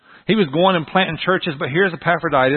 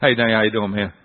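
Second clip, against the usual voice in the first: lower, casual buddy greeting; lightly acted low bro greeting; deep man-hug voice; 5 semitones lower than usual. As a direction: drop the pitch for a manly hello. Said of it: "your voice gets deep when you do this".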